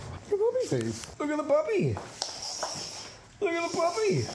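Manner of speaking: very high squeaky puppy voice. Real, not acted. Trainer coaxing a scared rescue dog, very high baby talk.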